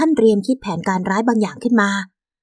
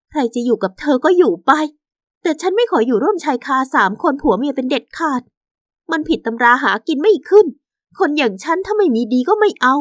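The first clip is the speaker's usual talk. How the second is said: higher-pitched, hurt wife; defiant wronged wife; 6 semitones higher than usual. Acted. Wife refuses to share her husband, hurt and firm.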